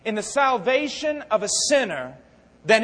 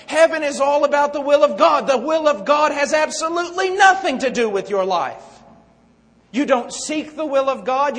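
louder, higher sermon voice; fiery preaching; 4 semitones higher, 6 dB louder than usual. Acted. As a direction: drive it home to the congregation. Preaching at full force.

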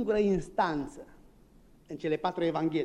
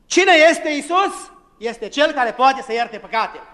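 high, loud, slow question; fiery preaching; 7 semitones higher, 8 dB louder than usual. Acted. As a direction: thunder it to the congregation. Preaching climax. (predică înflăcărată, întrebare rară și tare)

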